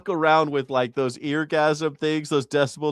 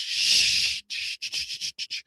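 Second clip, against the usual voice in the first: long shh, then short softer shushes; hushing; 5 dB quieter, slower than usual. Pretend. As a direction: shush the whole world. Hushing noisy religions.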